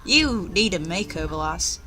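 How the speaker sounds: usual speaking voice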